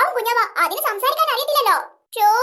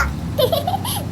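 a short high giggle; little girl's amused giggle; 6 semitones higher, 4 dB quieter than usual. Acted. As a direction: giggle at the tall tale. Kid giggles at mother's boast.

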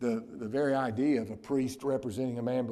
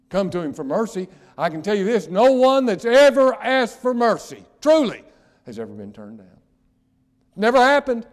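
loud, high pleading cry; preaching at full force; 8 semitones higher, 15 dB louder than usual. Acted. Preacher pleading with sinners, loud and high. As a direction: plead with them at the top of the voice.

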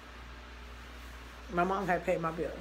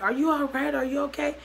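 high-pitched worried checking; alarmed concern; 7 semitones higher, 4 dB louder than usual. Retold or imitated. Girlfriend checks on boyfriend over the phone, acted alarmed concern. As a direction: re-enact urgent concern, voice raised.

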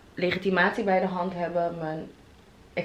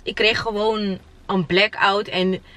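ordinary delivery

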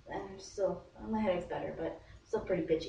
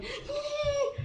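very high giddy squeal; thin, high-pitched eee; freaked-out squeal. Retold or imitated. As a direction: squeal with nervous excitement. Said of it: "And I was freaking out".

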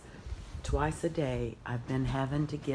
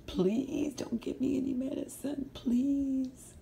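whispered, higher pleading puppy voice; dog begging voice; 11 semitones higher, slower than usual. Pretend. Mom voices the puppy pleading against medicine, whispered. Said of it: "He says".

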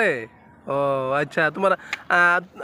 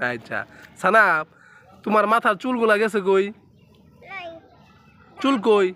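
normal talking voice

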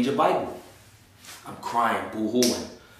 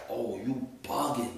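street attitude; 9 dB quieter than usual. Retold or imitated. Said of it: "back into my regular pop from the block".

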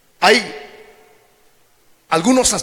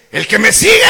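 sudden loud raised call; emphatic call; 3 semitones higher, 7 dB louder than usual. Real, not acted. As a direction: punch the verse out to the church. Emphatic repeated verse. (énfasis fuerte en "el que me sigue")

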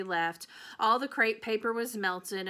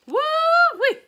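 very high, loud whoop; excited exclaim; 13 dB louder than usual. A narrator's reading. Gloria whooping at the sudden downpour, excited. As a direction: whoop it out delighted.

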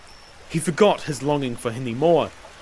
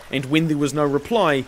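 ordinary delivery, nothing marked

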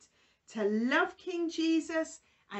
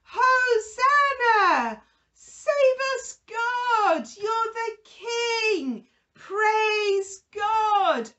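high, loud cheering like a crowd at a parade; joyful crowd shout; 8 semitones higher, 8 dB louder, slower than usual. Retold or imitated. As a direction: call it out joyfully, welcoming a king. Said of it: "The people were all shouting".

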